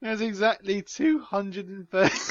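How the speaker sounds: high-pitched, helpless giggling read; laughing while reading. Real, not acted. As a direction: struggle to read it out through rising laughter. Reader cracking up while reading aloud.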